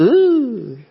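high, drawn-out "ooh"; playful high coo. Pretend. Pastor playfully asks who would do this, high mock coo. Acts reaction to a baby.

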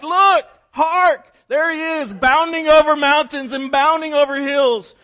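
very high, very loud, slow rapture; ecstatic bride. Acted. Bride sees groom bounding over hills, shrieking with joy. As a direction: cry it out as if swooning. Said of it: "And she's like".

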